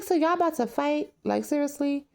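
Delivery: high disbelieving reaction; incredulous. Retold or imitated. Vlogger reacting to the fight, high and incredulous. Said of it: "I'm like".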